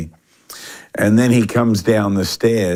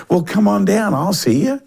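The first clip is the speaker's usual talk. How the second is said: imitated East Tennessee accent, high-pitched; 12 semitones higher than usual. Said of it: "with that East Tennessee accent".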